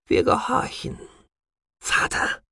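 whispered, high submission. Acted. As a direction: whisper the surrender grudgingly. Daughter gives in, resentful.

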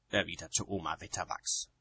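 ordinary delivery

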